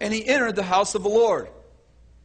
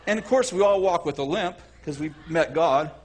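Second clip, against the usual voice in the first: ordinary talk, nothing marked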